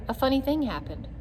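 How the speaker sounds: ordinary delivery, nothing marked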